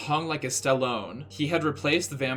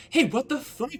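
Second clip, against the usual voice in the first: much higher, loud outraged yell; angry shout; 12 semitones higher, 4 dB louder than usual. Acted. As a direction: explode at the thief. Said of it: "Vampire shooted angrily".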